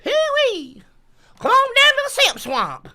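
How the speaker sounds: high, swooping Southern holler. Acted. Guide calls tourists to Simp Swamp, high whooping holler. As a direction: holler a backwoods welcome.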